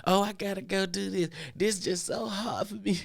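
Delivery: high, slow whiny pleading; mock a coworker faking hardship; mock sob-story whine; whiny fake excuse. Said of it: "You don't have to play games".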